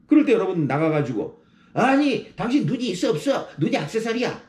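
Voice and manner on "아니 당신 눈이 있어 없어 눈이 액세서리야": 높은 톤으로 시비 거는 운전자; 차 박은 상대에게 따지듯 쏘아붙여; mock road rage. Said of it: "그렇게 싸워서는요". (뒤차 운전자에게 따지는 화난 운전자)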